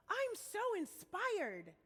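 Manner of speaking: high, drawn-out mock admiration; gushing inspired onlooker. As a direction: stretch it out, gushing over someone. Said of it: "and you think to yourself".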